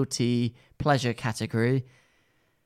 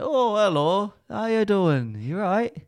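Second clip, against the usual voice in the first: higher-pitched, friendly Northern shopkeeper; Northern accent; 5 semitones higher, slower than usual. Retold or imitated. Imitating Mop a Top greeting customers, Northern accent. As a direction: put on a bit of a Northern accent. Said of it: "This is a bit Northern".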